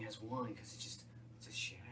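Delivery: fast, very soft whisper. Acted. Stoner musing on shapes, hushed whisper.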